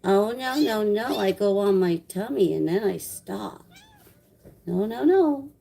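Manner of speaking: high, cute kitten voice protesting; pet-owner baby voice for kitten; voicing the kitten. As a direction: speak for the stubborn kitten, whiny and high. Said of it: "She's like".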